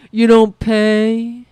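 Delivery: a hint of a blustering boss, slow, "pay" leaned on; half-hearted Trump impression; mock Trump taunt. Mocking Trump scolding allies, lightly voiced and slow. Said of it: "if we stop saying".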